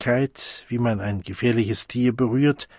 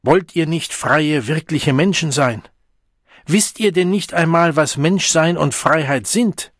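high, loud impassioned questions; eloquent rage; 6 semitones higher, 5 dB louder than usual. A narrator's reading. The Savage challenges the Deltas, fervent with rage. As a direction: harangue the crowd angrily. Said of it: "Die Wut machte ihn beredsam".

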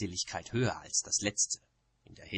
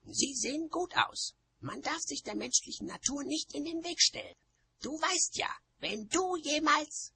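high, defensive witch voice; high-pitched protesting witch; Nanny, quarrelling. A narrator's reading. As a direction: argue back in a high, strained voice. Nanny argues back, cut off.

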